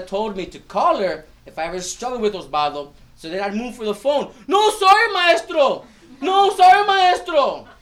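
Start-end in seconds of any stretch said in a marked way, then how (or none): 4.5-7.7 put-on high, loud, hurried kid's apology; panicked child. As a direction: beg the teacher not to call mom. Child stopping teacher phoning mom.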